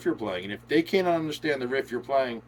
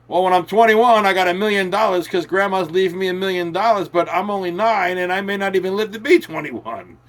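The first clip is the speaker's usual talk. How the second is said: higher, louder mock kid boast; bragging nine-year-old; 5 semitones higher, 5 dB louder than usual. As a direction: brag like a kid about a future fortune. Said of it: "that's like me saying".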